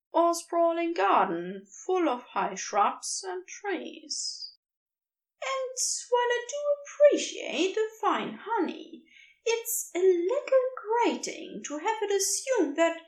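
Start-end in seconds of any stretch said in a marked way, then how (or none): 5.4-13.0 lightly raised, mildly put-upon voice; a gentle beast chatting away to itself; gentle creature voice. A narrator's reading. Creature rambling to itself while searching, lightly raised voice.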